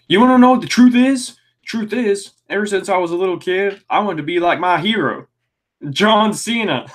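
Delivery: high-pitched, hammed-up wrestler promo. Wrestler in the ring confessing his hero, high and hammed-up. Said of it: "he goes".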